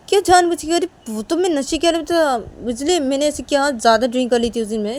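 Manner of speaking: indignant protest. Acted. Accused of doing it on purpose, indignant protest.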